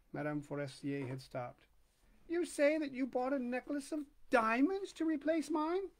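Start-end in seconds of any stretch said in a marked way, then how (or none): 2.3-5.9 incredulous lady. Acted. Forestier voiced.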